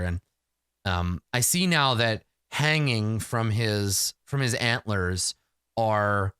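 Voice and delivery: usual speaking voice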